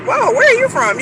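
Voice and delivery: a delighted, startled whoa; surprised. Retold or imitated. Meeting someone with an African accent, high and surprised. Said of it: "you're like".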